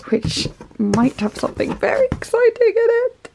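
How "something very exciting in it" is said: high sing-out of giddy excitement; excited anticipation. Real, not acted. Teasing viewers about the parcel she is opening, excited. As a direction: let the voice climb high with anticipation.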